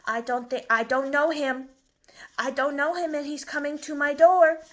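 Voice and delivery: agitation building to a loud finish; worried dog puppet. Acted. Dog protesting about unknown mailman at door, increasingly alarmed. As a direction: protest with rising volume and alarm.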